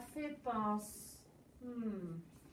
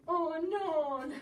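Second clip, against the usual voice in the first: a little louder, alarmed oh no; higher, alarmed exclamation; dismayed cry; 4 semitones higher, 4 dB louder than usual. Acted. Dismay, then repeated whispered.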